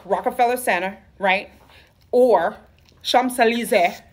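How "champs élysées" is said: Champs-Élysées said the French way, slow and careful; Champs-Élysées with a French accent; French pronunciation. Shown as a demonstration. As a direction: pronounce Champs-Élysées like a Parisian. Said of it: "Gotta say it like that".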